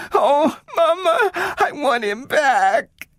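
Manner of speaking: put-on high, slow, drawn-out child wail; girl crying for her robot. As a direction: beg and wail. Child wails.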